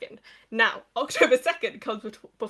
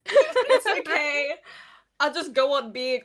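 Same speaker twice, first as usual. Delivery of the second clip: high, slow mock-wounded voice; mock hurt; 5 semitones higher, 6 dB louder, slower than usual. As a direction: play the wounded loser. Pretending to be hurt.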